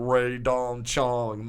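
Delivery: very slow, drawn-out manager impression; Barry Katz. Manager saying the name that cracks him up, slow mimicry. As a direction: drag out each syllable of the name. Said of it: "my manager going".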